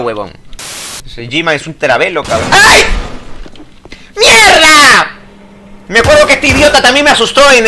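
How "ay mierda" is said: jolted by a scare; startled yelp. Real, not acted. Player startled by a monster, scared shout.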